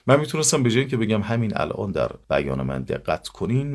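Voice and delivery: usual speaking voice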